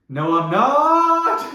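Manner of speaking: starts small then bursts out high and loud, dragged slow; sudden defiant shout. Shown as a demonstration. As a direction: blurt it out way too loud. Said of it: "So it was like".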